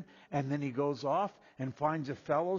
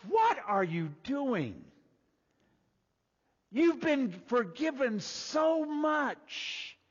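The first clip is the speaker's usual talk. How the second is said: incredulous rebuke; 9 semitones higher, 6 dB louder, slower than usual. Retold or imitated. Retold lord's rebuke.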